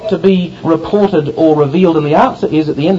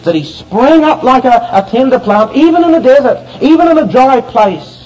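raised, ringing pulpit climax; impassioned preaching; 6 semitones higher, 4 dB louder than usual. Real, not acted. Preacher marvelling at God's care for the tender plant, impassioned. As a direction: drive each repeated phrase higher with conviction.

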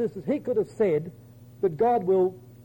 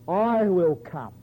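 "I" stretched slowly in "I will come"; stress on I; much slower than usual. Shown as a demonstration. Preacher showing where emphasis falls, "I" slow and weighty. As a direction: lean long on "I", then let "come" fall. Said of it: "show you where the emphasis should be".

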